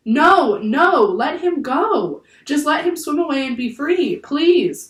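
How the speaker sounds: high, loud, drawn-out begging; scream, begging for his freedom; screamed plea. A narrator's reading. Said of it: "screamed Annie".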